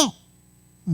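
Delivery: ordinary talk, nothing marked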